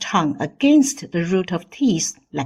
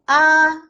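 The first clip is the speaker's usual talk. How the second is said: "Ah" high, loud and level, held long; first tone; 8 semitones higher, 4 dB louder than usual. Shown as a demonstration. Teacher leads repeat drill, high level ah. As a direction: hold "Ah" on one high note. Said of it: "The first tone is the highest".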